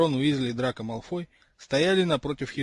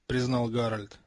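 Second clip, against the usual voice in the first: ordinary delivery, nothing marked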